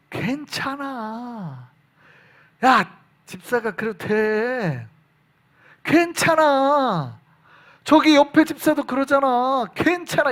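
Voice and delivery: slow, coaxing tempter voice; drawn-out honeyed whisper of temptation; sweet-talking Satan. Retold or imitated. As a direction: lure them in gently like a smooth tempter. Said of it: "사탄의 말은 달콤하고".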